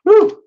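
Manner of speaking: very high, loud whoop; excited whoop. Real, not acted. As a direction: cheer at the top of the voice. Cheering as Robin comes up.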